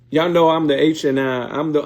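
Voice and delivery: ordinary delivery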